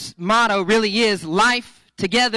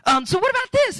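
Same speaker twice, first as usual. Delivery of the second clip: much higher, hopeful pleading voice; eager date guy; 11 semitones higher than usual. Retold or imitated. Retelling TV blind date guy desperately pitching, high eager voice.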